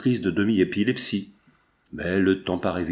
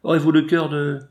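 higher, louder cry for rescue; acted distress call, raised and urgent; anguished cry for help; 6 semitones higher, 4 dB louder than usual. A narrator's reading. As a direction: call out desperately to be saved. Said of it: "Un cri d'angoisse".

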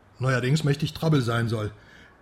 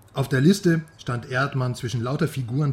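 ordinary talk

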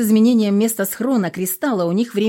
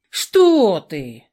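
many snarling voices overlapping; 7 dB louder than usual. A narrator's reading. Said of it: "Дружно замотали все три головы Змея Горыныча".

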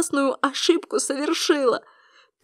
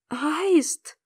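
a hushed, higher exclamation; startled cry; 4 semitones higher, 3 dB quieter than usual. A narrator's reading. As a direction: cry out in surprise, but softly voiced. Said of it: "вскрикнула она". (удивлённый вскрик)

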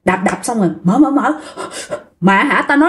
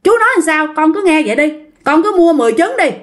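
scolding; 6 semitones higher, faster than usual. Retold or imitated. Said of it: "chú này mới la bạn".

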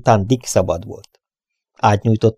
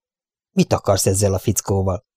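ordinary delivery, nothing marked

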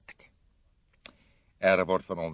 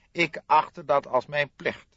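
lightly voiced stiff notary, higher and slow; a formal notary, a touch higher, slow; 9 semitones higher, 8 dB louder than usual. Notary explaining himself to De Kok, lightly voiced formal tone.

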